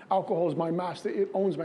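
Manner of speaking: ordinary delivery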